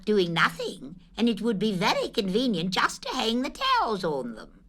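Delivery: high, lively Rabbit voice; high fussy rabbit; Rabbit's voice. Acted. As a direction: pitch Rabbit up, brisk and fussy. Rabbit voiced in read story.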